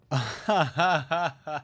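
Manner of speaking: a burst of amused laughter; real laugh. Real, not acted. Amused by the skin's shield.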